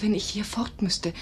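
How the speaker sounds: ordinary delivery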